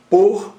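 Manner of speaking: "Por" punched high and loud; loud, emphatic "Por", standing alone; stressed monosyllable. Shown as a demonstration. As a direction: sound out "Por" as one strong beat. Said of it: "monossílabo tônico".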